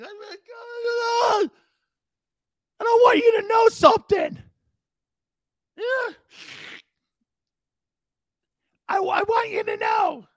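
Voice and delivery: blubbering pep talk yelled through mock tears; Marty Schottenheimer in tears. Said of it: "Here's Marty in tears".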